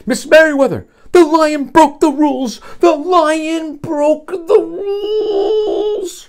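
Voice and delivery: high, loud tattling, ending in a long wail; calling out. Acted. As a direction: shout the news, stretching the last line. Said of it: "he called out as he walked".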